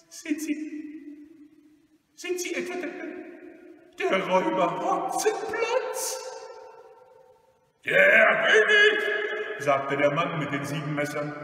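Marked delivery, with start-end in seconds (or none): 0.1-6.2 put-on very high, fearful old lady; anxious, high-pitched hesitant asking; frightened. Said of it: "mit ängstlicher Stimme fragte sie". 7.8-9.0 loud, high roaring announcement; booming robber's declaration; boastful robber. Acted. Robber proudly reveals himself.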